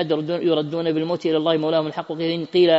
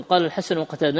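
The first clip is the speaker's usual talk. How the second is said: ordinary talk, nothing marked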